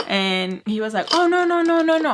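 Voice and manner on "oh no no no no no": high, loud string of denials; imitated hasty backtracking. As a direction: mimic a flustered man backing down. Voicing man backtracking to mother.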